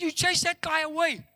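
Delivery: put-on high child's accusation; imitated little girl. Small daughter scolds father for chasing beggar, imitated high voice. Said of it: "five or six year old little girl".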